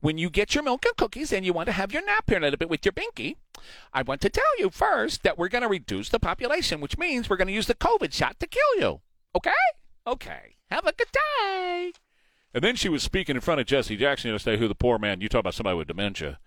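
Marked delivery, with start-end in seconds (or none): 0.0-11.9 put-on high sing-song voice for little kids; mock kindergarten teacher. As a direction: coo at them like a class of toddlers. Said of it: "or a kindergarten teacher".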